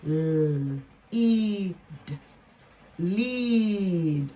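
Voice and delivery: held "l", long "ee" in "eat", then "lead"; blending sounds. Shown as a demonstration. Teacher blending another long-e word, sounded out. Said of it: "let's blend them together".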